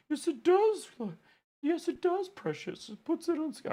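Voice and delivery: squeaky, hissing little creature; high creepy creature voice. Acted. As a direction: croon it like a creepy goblin. Creepy quote turned squeaky creature.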